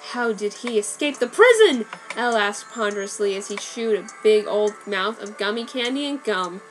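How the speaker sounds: usual speaking voice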